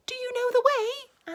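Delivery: very high, small-voiced question; high Piglet voice. A narrator's reading. Piglet asking Pooh the way, high and small. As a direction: squeak it like a tiny pig.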